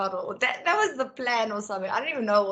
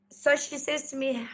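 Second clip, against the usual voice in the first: normal talking voice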